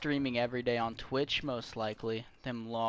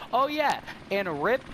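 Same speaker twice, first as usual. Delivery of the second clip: excited yelp; 9 semitones higher, 4 dB louder than usual. Real, not acted. Gamer reacting mid-match.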